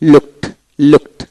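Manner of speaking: লুকড ending in a crisp t sound. Shown as a demonstration. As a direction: finish লুকড on t, not d. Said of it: "ট উচ্চারিত হবে". (লুকড, শেষে ট উচ্চারণ)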